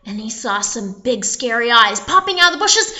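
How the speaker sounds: ordinary delivery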